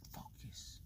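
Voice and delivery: breathy whisper to himself; whispered to self. Real, not acted. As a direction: whisper it to refocus. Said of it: "let's just focus".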